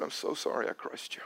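unvoiced whisper, broken and sorry; whispered apology. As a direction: breathe the apology softly.

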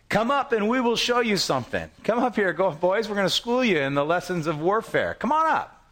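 high, cocky jeer; garrison taunting. Retold or imitated. Philistine garrison calling down to Jonathan, high cocky jeer. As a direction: dare them up the hill with a sneer.

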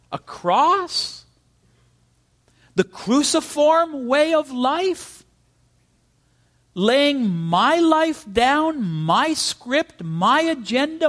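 high, slow, disbelieving voice of a believer; asking God in stunned disbelief; incredulous high questions. Acted. Preacher voicing a bewildered believer's protest, high and incredulous.

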